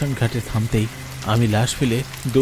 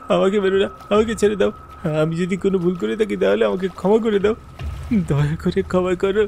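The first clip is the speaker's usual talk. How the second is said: higher frightened begging; pleading for life; 7 semitones higher than usual. Acted. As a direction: beg the ghost to spare you. Narrator begs the ghost.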